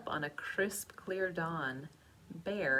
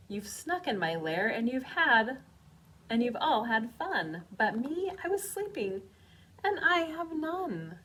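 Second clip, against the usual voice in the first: higher and louder whiny bear; sulky bear; 6 semitones higher, 8 dB louder than usual. Acted. Bear whining to his friends that he missed the fun. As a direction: complain like a left-out bear.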